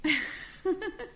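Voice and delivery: high, soft snicker; small high laugh under the breath; scornful giggle. Acted. Interviewer laughing over a pitied applicant's bio data, scornful giggle.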